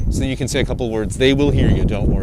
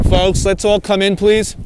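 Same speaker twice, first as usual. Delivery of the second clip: slow, raised call to a crowd; 5 semitones higher, 3 dB louder, slower than usual. Real, not acted. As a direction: summon the group in, drawn out. Calling the group to gather.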